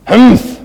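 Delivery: loud, high mock-haughty "humph"; imperious humph. Mother answering her son's protest, imitated haughty humph. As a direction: huff it out like an imperious Italian mother. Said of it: "imperial Italo-Italian accent".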